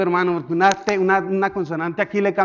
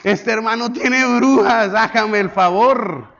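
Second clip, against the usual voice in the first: louder, higher, slow mock shock; mock alarm; 5 semitones higher, 6 dB louder, slower than usual. Pastor hears brujas and acts shocked. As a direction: act scandalized.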